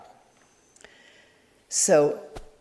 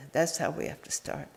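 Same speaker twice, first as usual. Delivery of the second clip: repeated much softer, to yourself; soft echo; 3 semitones lower, 9 dB quieter than usual. Shown as a demonstration. Teacher softly repeating the point to the sangha. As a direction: let the repeat sink in quietly. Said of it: "but I mention it because".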